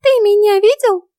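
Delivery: very high, squeaky little fairy voice; tiny fairy demanding an answer; indignant fairy. A narrator's reading. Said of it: "раздался голос Ульрики".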